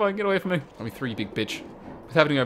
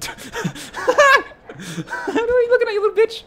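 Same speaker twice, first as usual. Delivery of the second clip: very high, loud, through laughter; laughing shriek; 4 dB louder, faster than usual. Real, not acted. Player confronting staring game figure, high laughing screech. Player startled, snaps at character.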